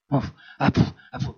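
puffed sighing breaths; breathy mock puff sighs; mock weary puff. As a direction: blow out like a tired Spaniard. Mimics Spanish sighing puff.

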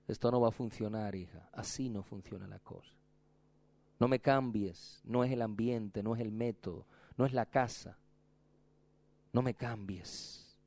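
voz del esposo, mucho más suave y lenta; el esposo corrige con ternura, casi en susurro; esposo tierno. Acted. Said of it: "Dice el esposo". (el esposo le habla a la novia, suave y pausado)

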